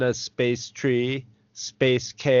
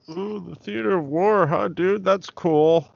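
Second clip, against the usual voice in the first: bad acting; 7 semitones higher than usual. Teasing the grand villain line.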